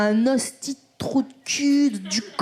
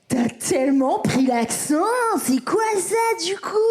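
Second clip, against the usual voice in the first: shocked family impression; 5 semitones higher than usual. French family mocking her accent, hammed-up shock. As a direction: imitate appalled relatives, pitch climbing on the question.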